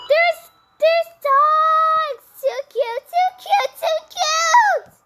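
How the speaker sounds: high, loud gushing squeal; excited squeal. Pretend. Player seeing cute dogs, squealing. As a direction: squeal it high, overcome by cuteness.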